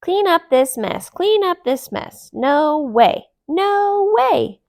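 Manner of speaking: high squeaky mocking echo; mimicking mouse. A narrator's reading. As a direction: parrot them back like a mouse. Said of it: "repeated Monty, the mimicking mouse".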